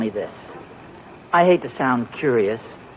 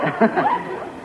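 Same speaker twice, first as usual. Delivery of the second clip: nervous laugh; 5 dB quieter than usual. Real, not acted. Caught talking about old flame.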